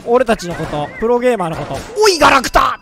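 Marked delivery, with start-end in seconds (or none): 2.0-2.8 louder, higher jeering yell; mock insult shout. Imitating a heckler insulting pro gamers, loud jeer. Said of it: "とか言ってる人".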